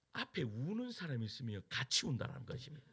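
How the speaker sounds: usual speaking voice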